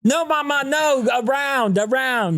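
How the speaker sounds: toddler telling mom off during drills, high-pitched bossy mimicry. Toddler Rue corrects mama during the drills, high bossy imitation. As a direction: imitate a small child insisting, pitched high.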